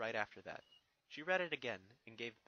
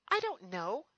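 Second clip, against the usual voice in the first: higher, lightly voiced young mare; a thoughtful pony princess, pitched up; Twilight's voice; 10 semitones higher, 9 dB louder, slower than usual. Twilight answers Spike, lightly voiced and higher.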